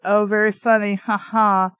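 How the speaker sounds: ordinary talk, nothing marked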